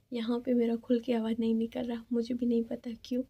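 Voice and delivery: normal talking voice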